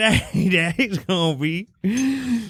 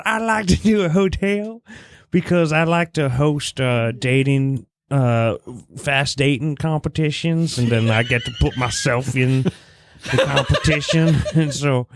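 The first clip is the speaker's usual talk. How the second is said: deep voice. Acted. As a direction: drop into a low goofy voice. Host plays contestant in deep voice.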